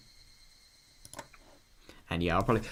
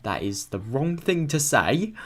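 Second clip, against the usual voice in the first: emphatic disbelief; 5 semitones higher, 7 dB louder, slower than usual. Real, not acted. Reacting to story line.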